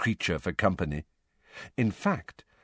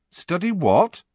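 ordinary delivery

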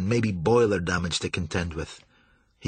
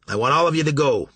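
firm order; 5 semitones higher, 5 dB louder, faster than usual. A narrator's reading. Captain ordering crew off.

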